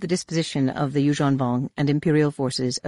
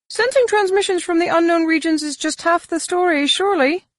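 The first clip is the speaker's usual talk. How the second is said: much higher, questioning alien; 13 semitones higher, 4 dB louder than usual. Acted. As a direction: voice him high and probing.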